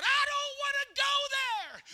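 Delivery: high, slow, loud desperate outcry; desperate cry. Retold or imitated. Dying man crying out on the road to hell. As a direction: cry out in terror.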